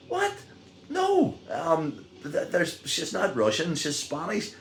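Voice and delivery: very high, incredulous no; high incredulous correction, settling down; astonished denial. Retold or imitated. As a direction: squeak out disbelief.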